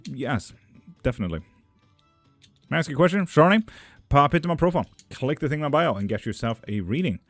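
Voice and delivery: ordinary delivery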